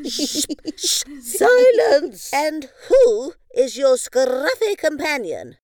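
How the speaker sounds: shushing then a high loud command; haughty queen demanding silence; imperious queen. Acted. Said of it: "asked Queen Moo".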